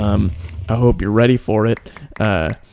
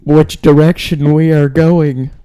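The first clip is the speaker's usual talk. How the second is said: loud, slow rhyming chant, climbing steadily higher; Gene Wilder; 6 semitones higher, 7 dB louder than usual. Acted. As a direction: build an eerie recital to a high, drawn-out peak. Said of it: "It's G-, Gene Wilder".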